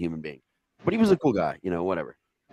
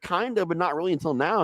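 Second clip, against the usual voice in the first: pitched way up, dazed and innocent; high stoned voice; 8 semitones higher than usual. Retold or imitated. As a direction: ask it in a high, spaced-out voice. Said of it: "I was like".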